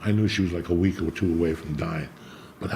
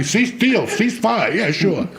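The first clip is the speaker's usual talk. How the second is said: much higher, mocking sing-song promise; mock Netanyahu; 11 semitones higher, 3 dB louder, much slower than usual. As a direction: wink while promising peace. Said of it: "I was like Netanyahu".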